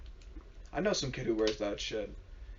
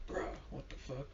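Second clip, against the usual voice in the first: whispered disbelief; stunned whisper; 12 dB quieter than usual. Retold or imitated. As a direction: breathe it out in shock. Retold stunned reaction.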